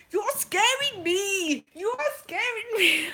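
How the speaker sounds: put-on high, loud panicky cry in a European accent; imitating a scared tourist's European accent; made-up European accent. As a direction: put on a rough foreign accent, slow and high-pitched. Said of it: "The accent that's gonna come out".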